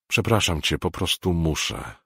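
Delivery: ordinary delivery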